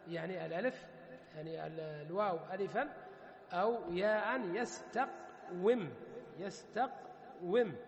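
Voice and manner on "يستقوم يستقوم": slow syllable breakdown. Shown as a demonstration. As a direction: split يستقوم slowly as yas-taq, pause, wim. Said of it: "الكسرة التي في يستقوم". (نطق يستقوم ببطء مقطعًا مقطعًا)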